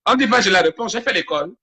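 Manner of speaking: high, loud retort; indignant protest. Real, not acted. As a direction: push back loudly at being corrected. Protests at being corrected on grammar.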